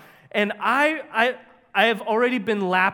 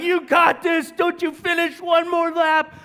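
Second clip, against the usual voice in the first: crowd cheering; 8 semitones higher, 4 dB louder than usual. Retold or imitated. They start cheering.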